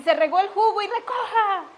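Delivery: half-earnest yelled order; mock mother's yell. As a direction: imitate a yelling mother. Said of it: "solo conoce el mundo y grita".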